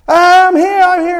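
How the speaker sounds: high, full-throated shouts toward the horn; screaming hard. Retold or imitated. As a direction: scream to be found. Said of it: "I'm screaming hard".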